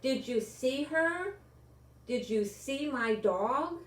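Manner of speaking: put-on boy raising his voice to ask about his lost dog. A narrator's reading. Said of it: "he continued in a louder voice".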